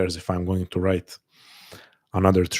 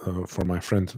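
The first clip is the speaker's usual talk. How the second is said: ordinary talk, nothing marked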